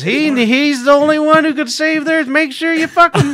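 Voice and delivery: very high, loud alien voice; shrill booming alien broadcast. Retold or imitated. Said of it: "doing this alien voice".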